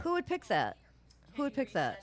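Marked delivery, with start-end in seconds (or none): 0.0-0.8 incredulous. Retold or imitated. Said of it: "repeated rhetorical question".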